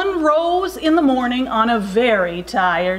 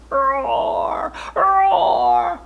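drawn-out roar, second one higher; lion roar; 6 semitones higher than usual. A narrator's reading. Narrator imitates lion's roars, drawn-out roaring.

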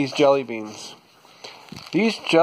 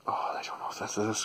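whispering; 6 semitones lower, 13 dB quieter, faster than usual. Real, not acted. Nervous before tasting jelly bean.

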